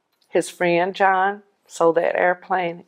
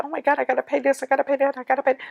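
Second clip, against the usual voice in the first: panicked worry; 7 semitones higher, much faster than usual. Retold or imitated. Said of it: "you're thinking".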